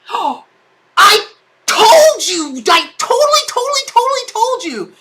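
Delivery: high, slowed, gloating squeal; high, thrilled gloating at her friend; gleeful i-told-you-so. Real, not acted. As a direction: crow it, delighted.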